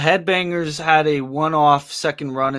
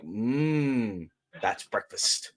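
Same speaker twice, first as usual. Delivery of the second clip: mock hungry murmur; 4 semitones lower, 6 dB quieter, much slower than usual. Imagined person eyeing bats as food.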